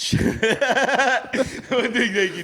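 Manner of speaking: slow, laughing through the words. Real, not acted. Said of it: "You said doo-doo".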